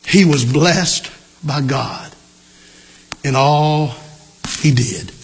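solemn slow repeat. Real, not acted.

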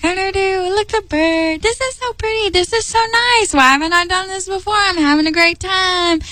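high, gushing excitement; giddy delight. Retold or imitated. Recalling herself ignoring God to admire a bird, high giddy gushing. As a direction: squeal over the pretty bird, fast at the end. Her own giddy self in stand.

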